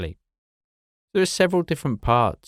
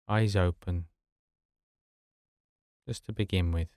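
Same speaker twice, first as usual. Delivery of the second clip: guided meditation; 2 semitones lower than usual. Acted. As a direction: ease them gently into rest, unhurried. Begins leading a sleep meditation.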